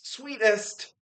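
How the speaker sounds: high, loud mock yell; imitated yell. Streamer imitates how he yells the word, high imitated yell. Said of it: "And he yells".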